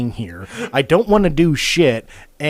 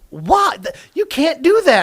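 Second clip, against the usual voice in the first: much higher, shrill disbelief; indignant manager; 13 semitones higher, 6 dB louder than usual. Retold or imitated. Manager objecting to the schedule, high indignant disbelief. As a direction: protest like it's outrageous, pitched way up. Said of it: "she just goes".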